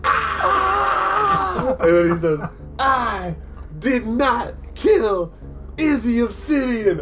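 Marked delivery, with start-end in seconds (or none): 0.0-1.7 high, shouted crowd roar. Retold or imitated. GM imitates the arena crowd reacting, a high roar. 2.8-7.0 higher, slow, deliberate vow; raised declaration. Acted. Barry tells the crowd he didn't kill Izzy, slow and high.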